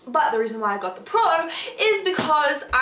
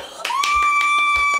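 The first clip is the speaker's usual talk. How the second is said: sharp high gasp; loud gasp; 5 dB louder than usual. Acted. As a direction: gasp in shock. Gasp at the news.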